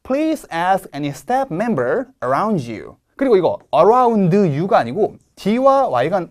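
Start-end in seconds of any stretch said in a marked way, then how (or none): 0.0-3.0 please and staff member stressed, ask swallowed; very slow, lean on please and staff member; slow model reading. Shown as a demonstration. Teacher reads the model sentence for students to hear. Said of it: "잘 들어보실게요".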